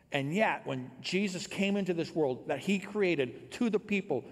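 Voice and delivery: loud, driving sermon delivery; full-force preaching. Acted. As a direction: preach it out to the whole congregation.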